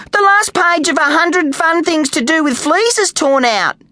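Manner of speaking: put-on high-pitched child's wail; lamenting. A narrator's reading. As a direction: lament it like an upset little girl. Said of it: "lamented Kylie".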